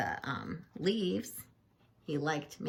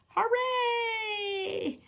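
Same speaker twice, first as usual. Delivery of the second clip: high, excited shout of hooray; crowd-style victory cheer. Acted. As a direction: cheer the touchdown at full voice. Everyone cheers a touchdown.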